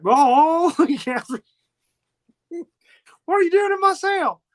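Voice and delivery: high, loud mock surprise; hammed-up astonished greeting; startled. Retelling Frank startled by Catherine.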